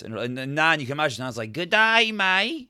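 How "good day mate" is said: high-pitched Australian Nan; mock Australian accent. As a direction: put on a broad Australian greeting, pitched up. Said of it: "pretend to be Australians".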